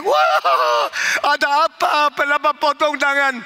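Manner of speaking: high, loud shocked scream; wife's high, loud horrified cry. Retold or imitated. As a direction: shriek in horror at the cut hand. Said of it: "istrinya teriak".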